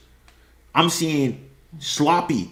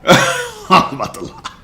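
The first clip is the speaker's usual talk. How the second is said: laughing repeat of the admission. Real, not acted. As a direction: laugh it out at himself.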